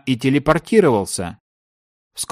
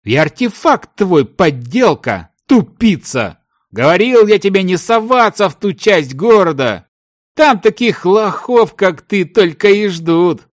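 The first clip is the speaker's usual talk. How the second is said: angry scolding; 6 semitones higher, 3 dB louder than usual. A narrator's reading. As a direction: tell off a foolish partner. Partner keeps scolding in the quarrel. (сердитая отповедь на повышенных тонах)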